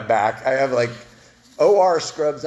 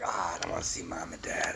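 whispered, much softer reluctant reply; 8 semitones lower, 14 dB quieter than usual. Retold or imitated. Told to visit his parents, he whispers reluctantly. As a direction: breathe it out under your breath. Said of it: "I'm like".

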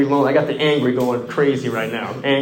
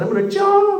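high, loud cracking cry; breaking cry; 6 dB louder than usual. Retold or imitated. Caller telling roommate his plan, cracking into a cry. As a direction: voice breaks into a wail. Confessing plan, bawling after.

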